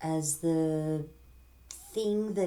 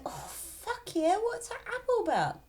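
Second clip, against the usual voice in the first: put-on much higher, eager girly voice; eager Eve. Eve answering the snake, high and eager. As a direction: gush over the apple like a giddy girl. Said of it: "Eve's like".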